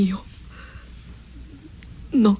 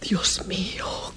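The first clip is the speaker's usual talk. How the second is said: despairing whisper; 3 dB quieter than usual. Acted. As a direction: breathe it out hopelessly. Mother despairs aside. (susurro desesperado)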